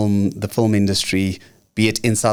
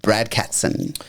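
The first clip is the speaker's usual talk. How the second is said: announcer voice; 4 semitones higher than usual. Acted. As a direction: draw out the welcome like a host. Host introducing guest, announcer style.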